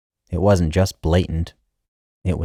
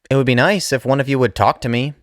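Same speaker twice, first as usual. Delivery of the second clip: higher, fast plea; pleading mother; 8 semitones higher, faster than usual. Acted. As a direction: press them, a bit hurt.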